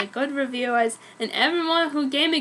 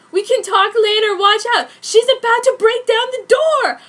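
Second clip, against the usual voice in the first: much higher, louder alarm; panicked warning; 9 semitones higher, 9 dB louder than usual. Acted. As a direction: warn of danger at the door. Warning of attacker breaking in.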